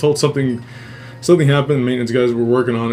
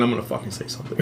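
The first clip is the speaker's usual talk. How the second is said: under-breath vow; 4 semitones lower, 11 dB quieter, much faster than usual. Retold or imitated. Muttered private vow.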